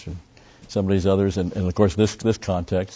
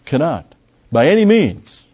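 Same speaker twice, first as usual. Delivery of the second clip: high, loud preaching climax; high loud insistent preaching; emphatic preaching; 7 semitones higher, 4 dB louder than usual. Acted. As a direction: drive the point home loudly. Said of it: "it makes it very emphatic".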